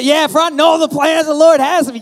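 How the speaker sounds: high, loud, eager believer voice; quoting a churchgoer. Retold or imitated. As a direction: say it like a proud believer. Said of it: "Anyone ever said that?".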